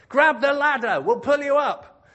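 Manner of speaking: very high, very loud holler; shouted call. Acted. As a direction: shout down from a helicopter. Helicopter rescuer calling down.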